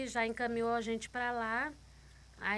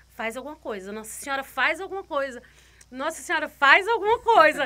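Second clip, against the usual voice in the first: desperate plea swelling to a loud cry; begging Our Lady harder and louder each time; silent scream of prayer; 3 semitones higher, 11 dB louder than usual. Retold or imitated. Mother praying over her limp baby, building to a loud cry. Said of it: "Gritando, Nossa Senhora".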